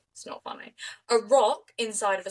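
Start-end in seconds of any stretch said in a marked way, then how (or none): 0.1-0.7 unvoiced guilty whisper. Real, not acted. Whispering an aside to her co-host who laughed. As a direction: whisper it as if you shouldn't laugh.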